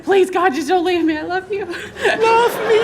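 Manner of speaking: high, laughing fake begging; hammed-up plea not to be left; clingy pleading, half laughing; mock desperate pleading.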